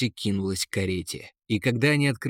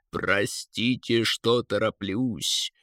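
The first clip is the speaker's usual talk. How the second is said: eagle's voice; 5 semitones lower, slower than usual. A narrator's reading. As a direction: play the eagle slow and unhurried, sinking low. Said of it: "раздался голос орла". (медленный низкий голос орла)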